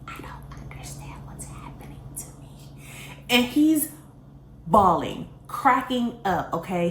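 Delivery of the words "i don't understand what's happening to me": frightened breathy whisper, barely there; paranoid whisper. Retold or imitated. Stoned girl whispering to her boyfriend, paranoid whisper. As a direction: hush it like a secret nobody can hear. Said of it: "And I was whispering".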